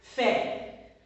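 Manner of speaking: ordinary delivery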